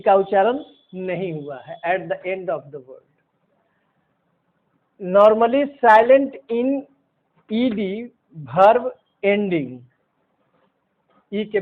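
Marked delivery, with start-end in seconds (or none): 1.9-9.8 very slow, each word apart; slow dictation. Shown as a demonstration. Teacher dictating the E rule very slowly. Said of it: "teacher dictating the rule".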